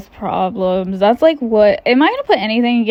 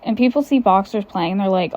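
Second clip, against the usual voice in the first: usual speaking voice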